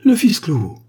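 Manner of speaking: loud, high cry of recognition; sudden high exclamation; shocked cry. A narrator's reading. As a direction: cry out in horror. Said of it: "poussa un cri".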